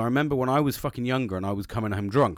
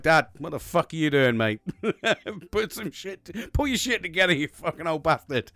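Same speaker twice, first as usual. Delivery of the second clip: laughing scold; 4 semitones higher than usual. Retold or imitated. Retelling telling naked dad off.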